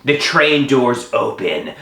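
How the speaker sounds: ordinary talk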